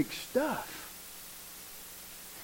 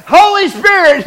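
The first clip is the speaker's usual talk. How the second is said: high, loud "Holy" cut through with laughter; laughing shout; 10 semitones higher, 13 dB louder than usual. Real, not acted. Preacher repeating the name to the congregation, laughing shout.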